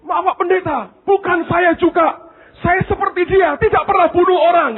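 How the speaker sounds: high, pleading nervous voice; frightened student. Retold or imitated. Scared Indian student answering the pastor, high and pleading.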